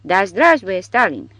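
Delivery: put-on loud, blissful drunken bellow; a tipsy soldier yelling toasts; drunken roar. A narrator's reading. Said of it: "răcnie încavedistul în culmea beatitudinii".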